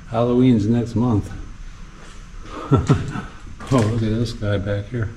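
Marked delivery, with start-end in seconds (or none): 2.2-3.6 airy amused laugh under the breath; breathy giggle. Real, not acted. Exploring a flooded basement, chuckling softly at the decorations. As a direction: let a quiet laugh slip out.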